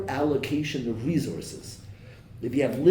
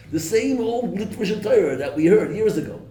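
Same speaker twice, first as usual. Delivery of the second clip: mocking old refrain; 9 semitones higher, 8 dB louder than usual. Mock complaint of listeners.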